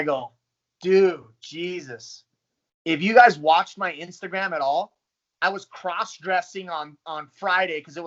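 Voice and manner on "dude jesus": slow, drawn-out groan of disbelief; exasperated groan. Retold or imitated. Fighter told to cut thirty pounds, drawn-out groan. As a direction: let it sag out, half laughing. Reacting to weight cut news.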